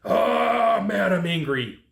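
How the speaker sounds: drawn-out, high mock yell; hammed-up angry yell that settles as it ends; mock screaming. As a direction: act out venting, stretching the first words. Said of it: "screaming and yelling".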